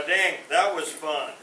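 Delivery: usual speaking voice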